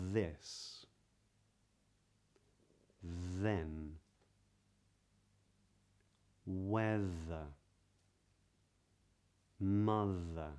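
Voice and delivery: "This", "Then", "Weather", "Mother", "Bathe", "Loathe" said slowly with a light voiced TH; voiced TH words. Shown as a demonstration. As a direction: model each voiced TH word clearly. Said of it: "here are some voiced T H words to practice".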